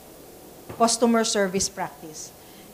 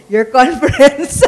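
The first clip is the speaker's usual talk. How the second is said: slow, loud words broken by laughter; laughing; 4 semitones higher, 10 dB louder, slower than usual. Real, not acted. Speaker teasing Jeff about his conference, laughing loudly. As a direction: laugh right through the words.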